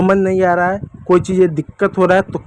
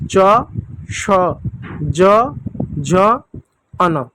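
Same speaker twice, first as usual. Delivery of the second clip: each letter spaced and clear; teacher leading the alphabet chant; letter drill; much slower than usual. Shown as a demonstration. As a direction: recite for children to repeat. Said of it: "एक बार मेरे साथ पढ़िएगा".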